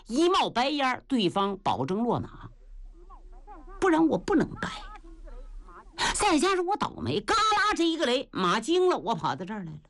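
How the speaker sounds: a beaten warrior telling his woes; young warrior Yue Ba. Acted. Yue Ba explains to old man. (岳霸向老人家诉苦，低沉委屈)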